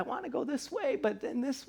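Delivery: a mock inner debate, voiced high; torn inner voice. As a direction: act out being pulled two ways. Acting out inner indecision.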